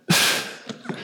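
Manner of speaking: a long, breathy, unvoiced sigh; heavy sigh. Real, not acted. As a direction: let out a weary sigh before going on. Preacher pausing, overwhelmed by crowds.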